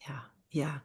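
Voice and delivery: soft whispered agreement. Real, not acted. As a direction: breathe a quiet yes yes. Listener murmurs agreement.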